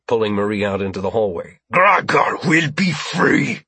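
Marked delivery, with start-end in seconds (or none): 1.7-3.6 slow, high alien shout; drawn-out, raised alien roar; alien's shouted demand. Acted. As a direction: declare it as a defiant alien captor. Alien hostage-taker keeps shouting demands.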